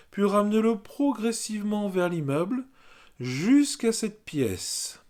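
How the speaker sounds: measured, sing-song meditation guidance; guided yoga nidra. Real, not acted. Yoga teacher settling listeners on the floor, guided meditation voice.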